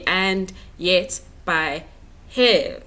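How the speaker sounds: "and yet by he-" slowly, ending loud on a single-beat "he-"; counted-out syllables. Shown as a demonstration. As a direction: punch each syllable of "and yet by he-". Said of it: "made it into one syllable".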